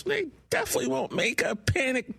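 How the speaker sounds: high, sarcastic falsetto voice; cutesy sarcastic. Acted. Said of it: "you're going cutesy sarcastic with your high register".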